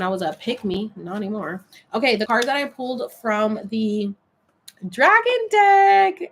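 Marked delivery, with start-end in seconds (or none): 4.9-6.3 loud, high, drawn-out flourish; slow, high-pitched whoop of a deck name; excited announcement. Real, not acted. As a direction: stretch it out like a hyped-up title call.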